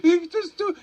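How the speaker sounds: high, loud brash retort; cocky answer. Retold or imitated. Narrator's brash answer to the club owner, loud and high. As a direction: toss it off with swagger.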